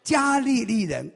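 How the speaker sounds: put-on thick Galilean accent, drawn out slowly. Peter denies being Galilean, in Galilean accent. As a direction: play Peter denying it in a heavy Galilean accent. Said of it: "他用他标准的加利利口音说".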